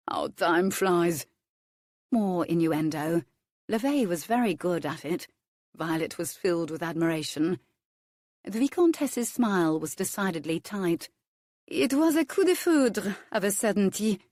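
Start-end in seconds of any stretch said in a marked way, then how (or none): 0.1-1.2 sly innuendo. Acted. Innuendo, remarked next line. 11.7-14.2 smooth reply. Acted. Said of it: "replied smoothly".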